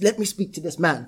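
normal talking voice

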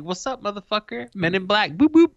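cool guy swagger. Retold or imitated. Showing off his new Ray-Bans, hammed-up cool swagger. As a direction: strut it like a movie agent, boop rising high and loud.

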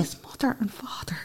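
whispering that the parents joined in; whispered. Real, not acted. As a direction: drop to a whisper to confirm it. Confirming in hushed aside.

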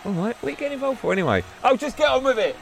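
high-pitched exasperated outburst; mock shouting. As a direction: raise the pitch sharply, as if fed up. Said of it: "Yeah, now he's shouting".

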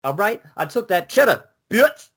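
high, hammed-up gangsta brag; gangsta rapper. Cohost raps Benjamin's line as gangsta, hammed-up brag. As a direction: swagger through it like a gangsta rapper.

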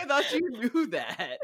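high mom line broken by laughter; laughing imitation of a dismissive mom. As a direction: give mom's answer through giggles. Mom's reply retold.

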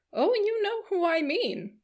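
very high, drawn-out knowing tease; teasing matron. A narrator's reading. Mrs Jennings teases the Colonel.

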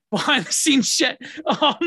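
breaks into a high laugh on it. Real, not acted. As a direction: laugh the words out, amused. Laughing at his old podcast.

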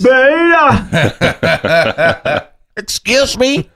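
high squeaky cry, then slowed; a sudden falsetto squeak; squeaky mock yelp. Mocking yelp at a friend.

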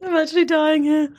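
shouting high through laughter; laughing outburst. Real, not acted. Laughing at balloon noise.

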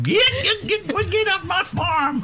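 angry farmer yelling at intruders; high, shouted get off my farm; shouting at trespasser. Acted.

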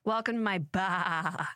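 slow, put-on Boston accent. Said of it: "how was my Boston accent".

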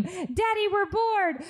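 put-on high whiny kid voice; mock whining bored children; kids' whiny scream. To dad, a whiny high kids' chorus. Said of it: "They scream".

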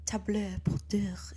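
soft, slow French accent; hushed, drawn-out French pronunciation. Shown as a demonstration. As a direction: purr it slowly in a breathy French accent. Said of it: "It's more the accent, I think".

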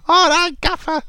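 very high, squeaky mock voice; cartoonish eager yes to the boss; Kevin Nolan. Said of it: "Kevin Nolan at the sideline".

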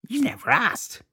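put-on high cackling old crone; snippy hag voice. Crone answers Maeve.

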